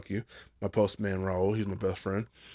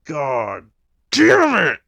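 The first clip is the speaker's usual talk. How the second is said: loud, slow drawn-out curse; frustrated curse at the new troop; 3 semitones higher, 9 dB louder, much slower than usual. Retold or imitated. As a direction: groan it out loud. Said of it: "I was like".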